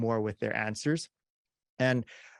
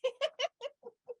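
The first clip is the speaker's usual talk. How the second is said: silent mime; 6 dB quieter than usual. Shown as a demonstration. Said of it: "I go".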